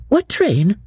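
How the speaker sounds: put-on high, earnest young woman's voice; young Lucy. Lucy answering her cousin Charlotte, earnest and high.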